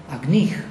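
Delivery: "Agnih" with the stress at the end, ag-NIH; second-syllable stress. Shown as a demonstration. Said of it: "stress falls on the second syllable".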